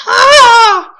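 very high, loud shriek; pain scream. Real, not acted. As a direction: scream out from the burn. Screaming from the burn.

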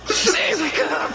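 hammed-up terrified yell to wake a friend; playful fake-panicked shout; mock panic. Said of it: "Just panicking".

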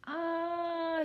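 long high drawn-out "ah"; sustained high yelp; falling cry. A narrator's reading. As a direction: cry out as you slip and tumble. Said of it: "he cried".

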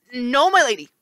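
high, nervous stammer; right face stammering to the lady. Acted. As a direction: stumble out the answer nervously. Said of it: "Janus's right face stammered".

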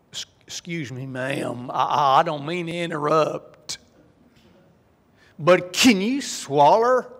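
slow Kentucky drawl. Acted. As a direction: play a slow, courteous Southern good old boy, stretching every word. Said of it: "in Kentucky, you know, the rhythms are kinda slow".

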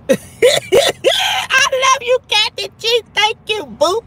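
high, drawn-out squealing love you; gushing squeal. Real, not acted. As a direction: squeal it out with delight. Squealing thanks for super chat.

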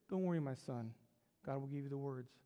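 low, soft fatherly murmur; serene reassurance. Acted. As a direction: soothe him quietly and evenly. Old preacher serenely reassures young one.

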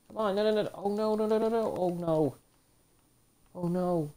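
real dismay as the match slips away; panicked no no no. Real, not acted. Gamer losing the fight, pleading no over and over in panic.

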